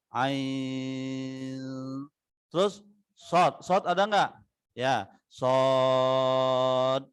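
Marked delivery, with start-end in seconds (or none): 0.1-1.5 Ain drawn out long and even; held Ain. Shown as a demonstration. Teacher drawing out Ain. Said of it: "Mat lazim juga". 4.7-7.1 sod drawn out six beats; held sod. Shown as a demonstration. Teacher reading sod with six harakat. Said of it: "Dibaca enam harokat".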